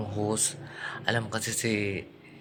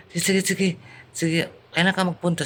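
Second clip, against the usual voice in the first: boss agreeing; 7 semitones higher, 4 dB louder than usual. Retold or imitated. Boss Madam replies eagerly.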